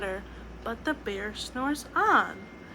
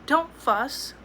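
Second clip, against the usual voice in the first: lightly squeaky mouse; 5 semitones higher, 5 dB louder than usual. Acted. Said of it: "Mouse squeaks".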